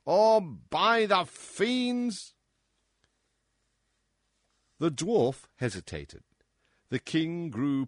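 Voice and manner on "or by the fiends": high, loud commanding shout. Acted. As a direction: bellow the order. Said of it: "shouted the monster".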